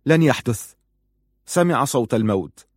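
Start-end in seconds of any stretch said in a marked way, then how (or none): 0.0-0.7 loud, high and emphatic; defiant, unyielding; forceful refusal. A narrator's reading. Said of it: "فقال في قوة".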